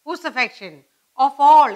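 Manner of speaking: ordinary talk, nothing marked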